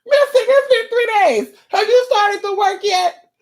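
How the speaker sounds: very high squealed caller impression; mock the impatient client in a squeaky voice; nagging client. Client phoning Mystic after three days, squeaky nagging. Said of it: "You might wanna pick up the phone".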